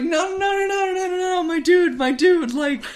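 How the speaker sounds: a soldier begging his captain to see sense; high pleading protest to a bro; pleading Mueller. Acted.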